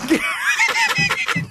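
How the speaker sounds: very high, squealing laugh; hysterical laughter. Real, not acted. Co-host reacting to the staged bar fight, hysterical laughter. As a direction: crack up helplessly.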